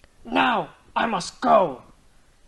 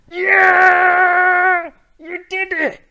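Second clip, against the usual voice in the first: cheering; 8 semitones higher, 9 dB louder, much slower than usual. Real, not acted. Cheering a success.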